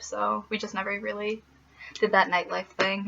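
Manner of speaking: ordinary talk, nothing marked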